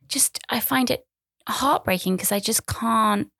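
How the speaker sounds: ordinary delivery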